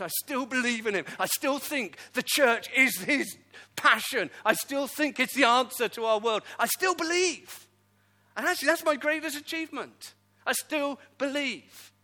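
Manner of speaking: loud, rising sermon climax, slowed on the key lines; preacher driving his conviction home to the congregation; preaching at full force. Acted. Preacher tells the church what he still believes, full force.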